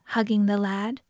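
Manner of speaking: plain reading voice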